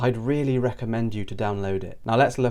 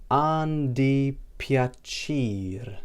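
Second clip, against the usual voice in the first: An di piacere very slowly, syllable by syllable; slow demonstration; 3 semitones higher, much slower than usual. Shown as a demonstration. Teacher repeats nice to meet you for learners, slowly drawn out. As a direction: stretch out every syllable of An di piacere.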